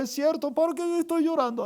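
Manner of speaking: high mock wail that drops away; imitated crying. Sister realises she needn't cry, acted tearful wail. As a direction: whimper it out like a weeping wife. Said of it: "Ella estaba llorando".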